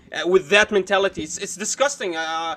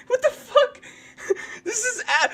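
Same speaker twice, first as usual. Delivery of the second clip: disbelieving laugh; 13 semitones higher, 4 dB quieter than usual. Real, not acted. Incredulous laughing at clip.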